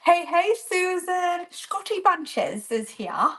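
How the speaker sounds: put-on a slow, half-hearted stab at a Scottish accent; mock Scottish accent greeting a viewer; failed Scottish accent. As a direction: try a Scottish accent and miss. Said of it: "That wasn't a Scottish accent".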